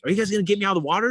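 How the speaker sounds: high, rushed retold question; incredulous plea. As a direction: ask the crew in urgent disbelief. Acts out his plea to boat crew.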